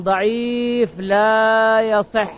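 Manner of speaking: ضعيف لا يصح drawn out, falling on لا; slow, deliberate ruling on ضعيف لا يصح; slow verdict. Shown as a demonstration. Preacher repeats the ruling to the congregation, slowly stressing ضعيف لا يصح.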